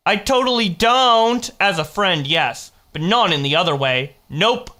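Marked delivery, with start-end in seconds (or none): 0.1-1.5 high, loud protest I totally don't; indignant denial. Real, not acted. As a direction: stress on don't, pitched up. Denying, voice jumps up.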